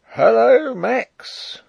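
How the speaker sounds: high, loud call across the moat; shouted hail. Acted. Guard hailing his comrade across the moat, high loud shout. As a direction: yell out to a friend in the dark. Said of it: "I heard shouted".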